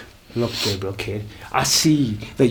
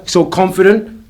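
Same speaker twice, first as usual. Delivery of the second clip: raised, taunting question; mocking taunt; 8 semitones higher, 7 dB louder than usual. Manager mocking the wrestler's confidence, taunting shout. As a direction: throw his boast back at him.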